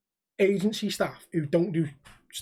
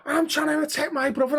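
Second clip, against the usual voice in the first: high-pitched mock protest; screaming lad; 9 semitones higher than usual. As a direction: imitate a worked-up lad defending himself. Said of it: "screaming at me".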